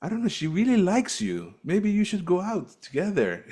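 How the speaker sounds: the father urging a date, mock Romanian accent. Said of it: "I don't know if that's the accent".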